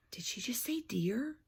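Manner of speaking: whispered aside, voice off. Real, not acted. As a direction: breathe the question to the listener. Aside to child, unvoiced.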